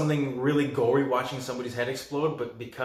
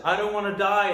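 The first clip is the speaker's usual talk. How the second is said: higher, louder mock plea; pleading victim; 8 semitones higher, 5 dB louder than usual. Doomed man to his killer, mock pleading. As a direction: imitate a panicked plea for life.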